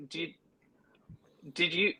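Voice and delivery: ordinary talk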